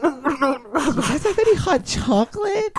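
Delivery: high, drawn-out customer whine; slow, pinched high voice from holding the nose; annoying girl voice. Retold or imitated. Customer asking for hot chocolate, annoying high voice. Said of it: "the voice down".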